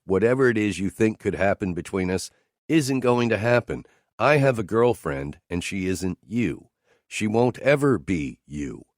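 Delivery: normal talking voice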